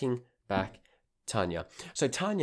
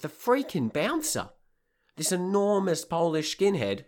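higher, louder, relishing it; excited build-up; 9 semitones higher, 4 dB louder than usual. Real, not acted. Telling listeners about the bouncer, excited. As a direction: paint the huge bouncer vividly.

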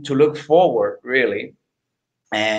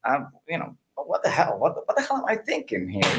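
quiet self-reproach; 9 dB quieter than usual. Retold or imitated. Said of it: "I'm like".

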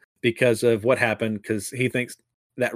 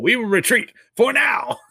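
much higher shouted declaration; dramatic retreat; 11 semitones higher, 3 dB louder than usual. Acted. Gildebar announcing the retreat to the good people, hammed-up shout. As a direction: proclaim it like a defeated villain.

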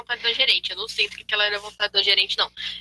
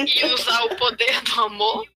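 laughing. Real, not acted. Joking about praying and love.

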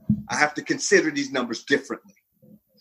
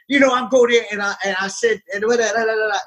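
fast, higher-pitched retold rant; launching into a rant; 8 semitones higher, faster than usual. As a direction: launch into it and tumble through the words. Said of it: "and then I launch".

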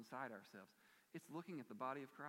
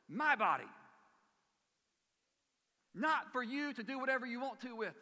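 loud, high, insistent claim on my body; ringing preacher's voice quoting Jesus; emphatic Jesus quote; 10 semitones higher, 12 dB louder than usual. Acted. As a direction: proclaim it as his, not theirs. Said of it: "So it's like".

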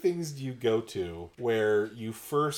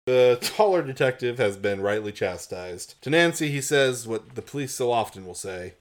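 ordinary delivery

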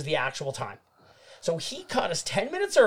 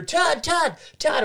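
much higher mock radio call; calling over radio; 11 semitones higher, slower than usual. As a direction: imitate someone calling urgently over the radio. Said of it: "Suddenly I hear".

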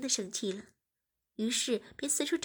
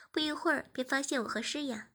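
ordinary delivery, nothing marked

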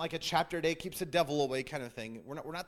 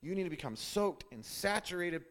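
normal talking voice

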